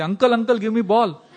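put-on high, loud child's shout for the ball; imitated kid calling. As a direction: call out like a kid in a street game. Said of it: "heard one kid say".

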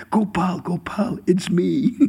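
slow, drawn-out call to his Lord; calling out, longing and eager. Retold or imitated. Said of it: "He was in the wrong place".

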